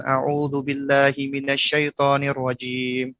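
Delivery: put-on slow, careful recitation for a child to copy; slow teaching recitation. Shown as a demonstration. Parent teaching an angry child the prayer, slow and careful. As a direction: spell it out gently so the child can follow. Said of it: "kita ajarkan dia".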